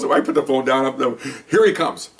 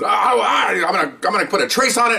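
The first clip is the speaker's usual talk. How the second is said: shouting threat; 9 semitones higher than usual. Retold or imitated. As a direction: shout it as the furious colleague making threats.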